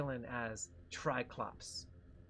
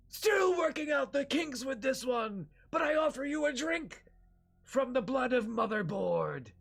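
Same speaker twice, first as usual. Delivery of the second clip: booming villain shout; 9 semitones higher, 6 dB louder than usual. Acted. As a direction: shout out the summons like a grand villain. Triclops offers drink to heroes.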